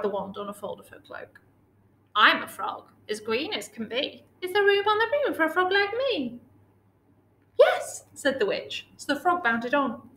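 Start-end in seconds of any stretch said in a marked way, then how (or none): 2.2-6.4 frog character voice, climbing high on the question; croaky little talking frog; croaking frog. A narrator's reading. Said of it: "said with a croak". 7.6-8.1 high, loud, delighted yes; leaping high with eagerness; witch's glad cry. A narrator's reading. Witch welcomes frog aboard.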